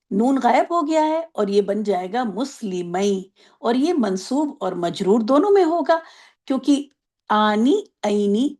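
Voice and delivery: ordinary talk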